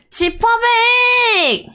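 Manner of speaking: long, high, sung-out shout; shouted toast call. Acted. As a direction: belt it out like a cheer.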